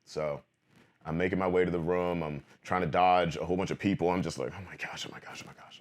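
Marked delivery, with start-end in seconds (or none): 4.6-5.8 panicked murmur fading into a breathless whisper; nervous whisper. Retold or imitated. Dodging convention crowds on the way to the room, a scared whisper.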